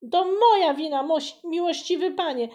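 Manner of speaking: high, loud, rushed plea; boy throws himself at the voivode's feet; blurting it out in one breath; breathless confession. Acted. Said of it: "wyrzucił z siebie jednym tchem".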